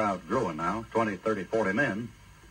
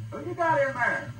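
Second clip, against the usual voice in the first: mob shout. Acted. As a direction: yell it up high like a rowdy crowd. Mob of Sodom at the door.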